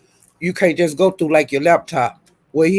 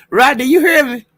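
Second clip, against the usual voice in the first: calling out; 6 semitones higher, 5 dB louder than usual. Real, not acted.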